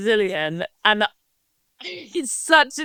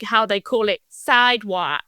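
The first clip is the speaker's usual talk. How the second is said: ordinary talk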